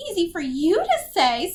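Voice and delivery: high, loud complaint; whiny retort. A narrator's reading. Beatrix resents Agatha's advice, whiny retort. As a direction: throw it back sulkily.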